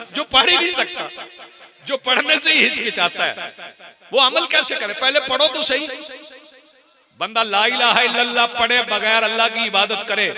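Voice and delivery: high, loud, insistent sermon; impassioned preaching. Real, not acted. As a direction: hammer the challenge home to the crowd. Preaches at full force.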